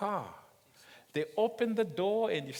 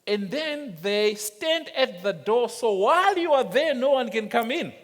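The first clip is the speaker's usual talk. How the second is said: louder, higher, rising to a peak; building emphasis; 7 semitones higher, 10 dB louder than usual. Acted. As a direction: build it up to the punch. Building to preaching climax.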